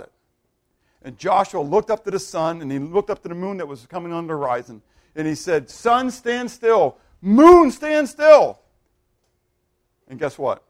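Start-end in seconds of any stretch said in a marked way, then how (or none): 5.7-8.6 slow, loud, high command; commanding cry. Acted. Joshua crying out to sun and moon, commanding. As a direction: cry it out, building louder on the second order. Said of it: "but almost like a command".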